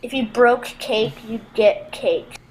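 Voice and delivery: normal talking voice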